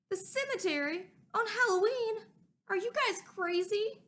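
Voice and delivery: high, loud disbelieving questions; you can't be serious; incredulous. Acted. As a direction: kid balking at a Halloween cemetery shortcut, incredulous. Francine balks at cemetery.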